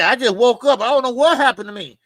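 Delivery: high, loud, dazed mock voice; loud whiny mock bewilderment; mocking Tim Bradley. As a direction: play him as if he just woke up confused. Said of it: "He said".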